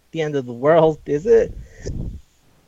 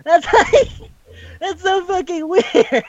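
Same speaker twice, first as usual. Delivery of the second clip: much higher, laughing through the words; 13 semitones higher, slower than usual. Real, not acted. Friend laughs at the weird fan scene, squeaking high. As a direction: squeal it while cracking up. Laughing after the story beat.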